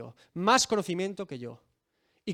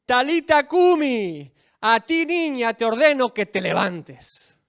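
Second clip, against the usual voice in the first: louder, slow, solemn command; commanding Jesus; 4 semitones higher, 9 dB louder, slower than usual. Acted. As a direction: order the girl to rise with authority. Jesus commands dead girl rise. (Jesús ordena a la niña, voz fuerte y lenta)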